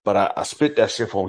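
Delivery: ordinary delivery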